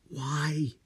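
weak hoarse croak in pain. Acted. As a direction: croak it out, barely voiced. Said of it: "he croaked".

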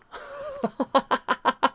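long high giggle; real high-pitched giggling. Real, not acted. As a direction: giggle high at your own joke. Laughs at his own bit.

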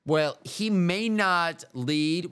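ordinary talk, nothing marked

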